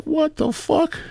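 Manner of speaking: very high-pitched mock alarm; acted shock. Abductee being probed, high acted shock. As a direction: act out appalled disbelief, voice shooting up.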